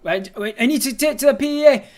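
very high, loud plea; desperate shout. Acted. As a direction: shout to be heard over crowd. Drowned out by crowd.

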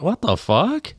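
high-pitched incredulous reaction; baffled disbelief. Retold or imitated. Local reacts to mocha frappe crowd, squeaky disbelief. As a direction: pitch it way up, bewildered. Reacting to fancy coffee drinkers.

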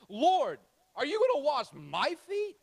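incredulous. Acted. Peter voiced in retold story.

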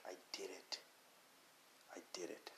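a hushed, unvoiced, awed whisper; whispered relief. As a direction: whisper it like you can't believe you made it. Crossing the finish line relief.

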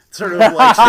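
laughing loudly at own joke; loud laughter. Real, not acted. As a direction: burst out laughing, high and loud.

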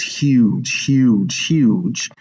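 "huge" three times, each one its own beat; emphatic repetition. Shown as a demonstration. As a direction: hammer each "huge" separately. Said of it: "in order for him to emphasize".

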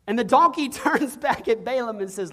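ordinary talk

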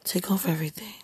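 hushed, whispered command; softly breathed, private demand; intimate whisper. Real, not acted. Same demand repeated in a whisper.

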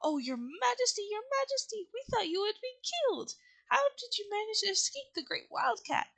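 high, excited little mouse voices; exclaiming mice. A narrator's reading. Mice greet their rescued Queen, high exclaiming voice. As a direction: exclaim like small subjects fussing over their queen. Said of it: "they exclaimed".

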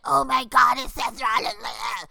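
loud, hammed-up fan squeal; mock starstruck fan. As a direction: shout it like a gushing fan, mockingly. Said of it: "see someone be like".

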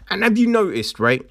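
usual speaking voice

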